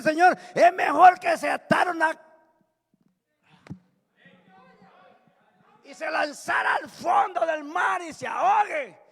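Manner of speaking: loud, high-pitched sermon shouting; full-force preaching. Real, not acted. Preacher warning the congregation about stumbling blocks, full-force shouted preaching. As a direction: hammer out the warning at full volume.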